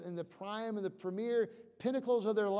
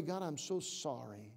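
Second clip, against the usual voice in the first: softer, lower apology to God; humble prayer; 4 semitones lower, 6 dB quieter than usual. Shown as a demonstration. Preacher modelling a believer's confession to God, softly. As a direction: say it quietly, head bowed.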